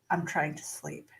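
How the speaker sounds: low, soft tired grumble; retold plea from someone half asleep; sleepy complaint. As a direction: murmur it low into the pillow. Retells exhausted plea from bed.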